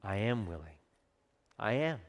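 gentle and sure, with a smile; soft promise to the leper; tender, smiling reassurance. Acted. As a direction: quietly say "I am willing". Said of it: "smiled and said".

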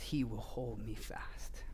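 closing hymn line whispered to the congregation. Acted. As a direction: breathe the hymn line tenderly.

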